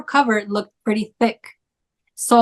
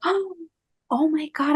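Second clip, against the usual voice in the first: high, soft stunned oh my God; barely able to speak from relief; breathless shock; 6 semitones higher, 5 dB quieter than usual. Retold or imitated. Grabbing the found passports.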